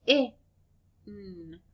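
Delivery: P-I-N split into separate sounds. Shown as a demonstration. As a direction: chop pin apart for the class. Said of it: "And the word is pin".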